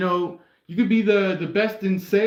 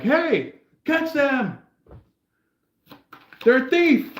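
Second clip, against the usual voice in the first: calling out; 9 semitones higher, slower than usual. Acted. Person stands up to expose a thief, high calling out. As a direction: raise the alarm on a thief. Acted bystander shouting after thief.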